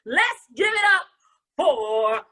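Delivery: high, loud, hugely stretched name; big announcer call. Real, not acted. Host bringing Jonah back, long hyped announcer call. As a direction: belt out the introduction, holding the name.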